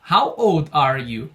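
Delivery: slow, deliberate model phrase; slow repeat for students. Shown as a demonstration. Teacher repeats the question slowly for students to copy. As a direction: lay out each word slowly. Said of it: "Vamos repetir novamente a pergunta".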